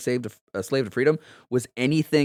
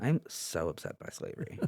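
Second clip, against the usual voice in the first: whispered effete liberal lament; breathy whisper of a prissy liberal; effete northern liberal voice; 6 semitones lower, 12 dB quieter than usual. Pretend. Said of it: "effete, uh, northern liberal, uh, voice".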